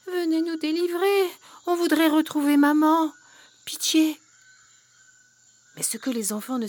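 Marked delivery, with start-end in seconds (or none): 0.1-4.2 pleading whisper, higher; frightened piglets pleading; whimpering piglets. Acted. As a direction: beg for rescue in a tiny whispered voice. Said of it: "qui gémissaient".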